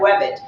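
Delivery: "webbit" with the R softened into a W; W-like first sound in "webbit", no R; British R dropped. Shown as a demonstration. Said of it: "you ain't pronouncing the word R".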